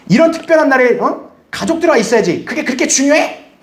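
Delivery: high mock scolding; indignant reproach. Family demanding presence on a special day, indignant reproach. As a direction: reproach them, pitch climbing on the question.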